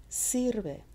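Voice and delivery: high "Sir" in "Sirve", then falling; stress on SIR. Shown as a demonstration. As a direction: lean on the first syllable of "Sirve". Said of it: "It's on the sir".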